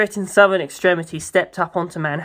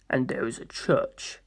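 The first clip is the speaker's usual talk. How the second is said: much lower and softer, slow; low slow priest; 5 semitones lower, 10 dB quieter, slower than usual. Acted. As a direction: answer quietly like a grave priest. Pucci answers low and slow.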